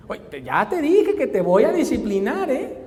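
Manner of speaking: loud, slow scolding threat; angry parent. Retold or imitated. Parent threatening child in car.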